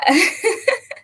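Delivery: high short laugh; a real high laugh. Real, not acted. Laughing after answer.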